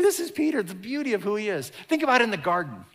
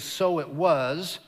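storyteller phrase; much slower than usual. Shown as a demonstration. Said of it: "we have this phrase".

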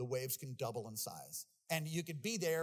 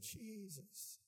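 breathed prayer; 6 semitones higher, 11 dB quieter than usual. Retold or imitated. Last breath prayer drowning.

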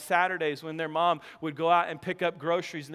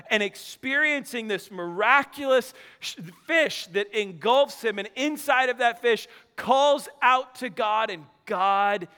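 raised, emphatic sermon delivery; impassioned preaching; 4 semitones higher, 4 dB louder than usual. Real, not acted. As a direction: preach it hard, stretching key words. Preaches on the passage.

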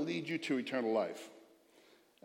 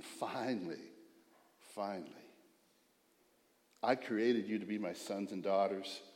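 lower, much softer, intimate; tender God; 7 semitones lower, 4 dB quieter than usual. Acted. God speaking to his sons and daughters, hushed and tender. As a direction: speak it gently like a longing father.

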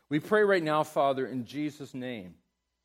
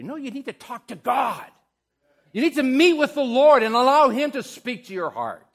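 shouting high and loud from the pulpit; preaching at full force; 12 semitones higher, 13 dB louder than usual. Real, not acted. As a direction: lift it into a fervent call. Preaching climax to congregation.

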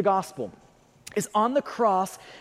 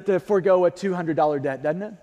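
ordinary delivery

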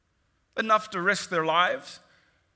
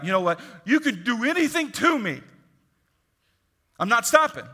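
louder, higher, defiant; defiant declaration; 5 semitones higher, 5 dB louder than usual. Retold or imitated. Believer facing threats from rulers, loud and defiant. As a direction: stand your ground, do your worst. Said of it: "What you'd be like".

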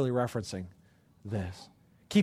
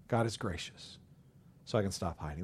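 much softer, lower, almost to oneself; hushed echo; 8 semitones lower, 10 dB quieter than usual. Acted. Preacher echoing the line to himself, hushed. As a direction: repeat it softly like a private prayer.

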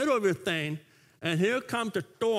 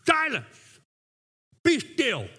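higher, louder, measured command; retold commanding rebuke; 6 semitones higher, 5 dB louder, much slower than usual. Preacher voices Jesus facing the waves, commanding rebuke. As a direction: command the waves, pausing between the two orders. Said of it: "But Jesus, looking at waves".

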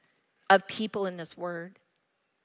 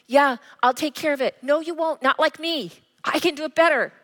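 high, cocky self-assured pushback; playing her own defiant self against God; stubborn retort; 6 semitones higher, 4 dB louder than usual. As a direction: insist you can do it better yourself.

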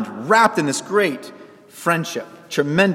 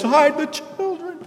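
mock alarm; 12 semitones higher, slower than usual. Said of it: "nobody says".

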